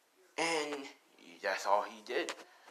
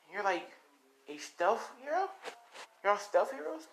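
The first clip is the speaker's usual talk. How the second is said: put-on high, questioning child voice; wondering young boy; 8 semitones higher than usual. Boy questioning a band of secret heroes, wondering. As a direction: puzzle it out in a boyish high pitch.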